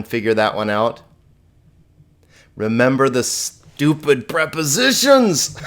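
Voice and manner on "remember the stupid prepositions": playful, hammed-up cry of exasperation; jokey raised-voice outburst; mock exasperated outburst. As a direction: exclaim it in mock frustration, rising into a laugh. Mock outburst, then laughs at it.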